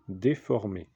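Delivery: normal talking voice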